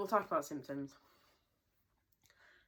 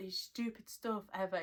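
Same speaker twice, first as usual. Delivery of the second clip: ordinary talk, nothing marked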